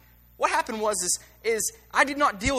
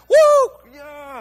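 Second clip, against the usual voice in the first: sudden high whoop of praise; mock worship whoop; 6 semitones higher, 16 dB louder than usual. Imitating a joyful worship shout at church. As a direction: whoop like a youth worship crowd.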